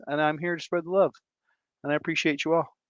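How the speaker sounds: normal talking voice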